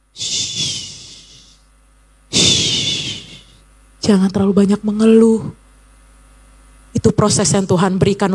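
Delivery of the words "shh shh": soft hushing shh; shushing. Acted. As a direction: hush them gently. Hushing the complainer. (desisan lembut menenangkan)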